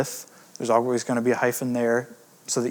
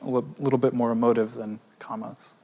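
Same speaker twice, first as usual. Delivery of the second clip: ordinary delivery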